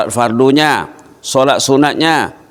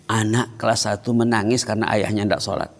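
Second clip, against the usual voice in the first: normal talking voice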